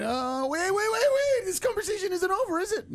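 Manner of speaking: very high, pleading mock panic; squeaky-high comic alarm; panicked backtracking. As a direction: scramble to stop them, voice shooting up. Acted panicked backtracking with father.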